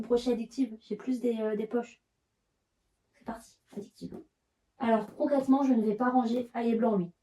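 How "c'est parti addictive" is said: whispered. Real, not acted. Muttering alone while tidying.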